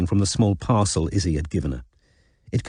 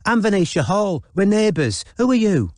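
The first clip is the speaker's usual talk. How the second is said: high, bright, breezy introduction; bold and cheery; 10 semitones higher than usual. Acted. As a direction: play a confident posh girl. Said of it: "imitating Izzy's bold, cheery approach".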